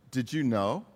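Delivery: ordinary talk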